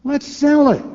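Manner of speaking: high, loud and decisive; his own retort quoted, sell said high, loud and final; emphatic retort. Retold or imitated. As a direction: slam the idea home with a loud, high punch on "sell". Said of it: "I said".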